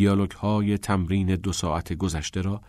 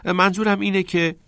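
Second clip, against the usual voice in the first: high, animated start of a tease; lifted, playful explaining; joking; 11 semitones higher, 4 dB louder than usual. A narrator's reading. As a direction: set up a joke brightly for a friend. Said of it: "نیل به شوخی گفت".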